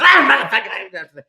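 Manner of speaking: mock spazzing-out customer; imitated outburst. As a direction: imitate a guy going nuts. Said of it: "Just goes nuts".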